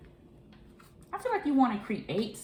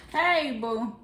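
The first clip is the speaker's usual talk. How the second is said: ordinary talk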